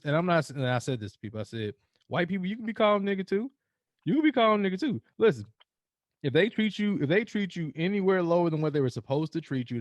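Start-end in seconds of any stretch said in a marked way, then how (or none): 2.1-7.6 rising emphatic declaration; mock preaching. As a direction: call it out like a sermon.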